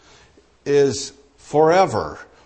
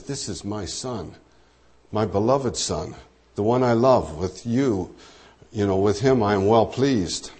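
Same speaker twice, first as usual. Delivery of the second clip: softer, lower fatherly affirmation; tender voice of God; 5 semitones lower, 4 dB quieter than usual. Acted. Preacher voicing God's love for Jesus, soft and low. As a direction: hush it like a loving father. God speaking of his beloved Son.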